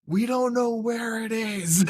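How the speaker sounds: high, slow hammed-up old man; mock grandpa voice. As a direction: imitate a panicky old grandpa. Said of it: "I hear the grandpa like".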